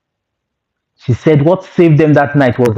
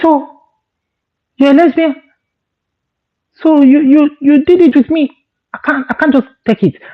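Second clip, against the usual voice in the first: much higher, stunned questions; shocked disbelief; 13 semitones higher than usual. Retold or imitated. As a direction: reel from the news. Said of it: "She said".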